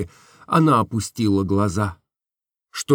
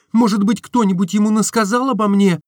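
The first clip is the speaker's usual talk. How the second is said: higher, anxious outcry; young woman crying out in alarm; cried out; 11 semitones higher, 3 dB louder than usual. Acted. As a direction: cry out in distress. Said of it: "Закричала она".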